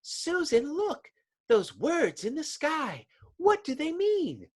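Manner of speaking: high, fluttery lady voice; shrill society matron gasping; society friend. Acted. Friend at tea pointing at the sky, high and startled.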